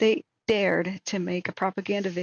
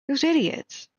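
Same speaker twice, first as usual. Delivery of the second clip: angry; 7 semitones higher, 4 dB louder than usual. Real, not acted. Angry rant about court ruling.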